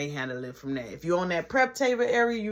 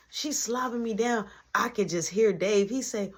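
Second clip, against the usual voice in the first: ordinary talk, nothing marked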